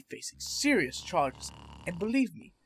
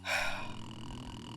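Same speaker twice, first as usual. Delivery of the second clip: weary sigh; 7 semitones lower, 10 dB quieter than usual. Acted. Doctor weighs the orderly's excuse.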